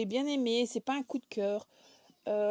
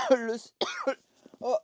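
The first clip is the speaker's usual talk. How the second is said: coughing; 2 semitones higher, 8 dB louder than usual. Real, not acted. Coughing fit mid sentence.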